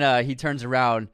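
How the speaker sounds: ordinary talk, nothing marked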